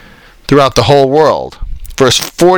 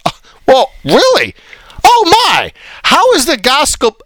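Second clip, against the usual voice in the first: mock surprise; 10 semitones higher than usual. Preacher mocking translation skeptics with hammed-up surprise. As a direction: feign shock to ridicule the doubters.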